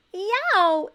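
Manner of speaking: high, loud yelp of pain; sharp loud yow from a stung nose. A narrator's reading. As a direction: yelp as the sting hits. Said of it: "Abel yammered".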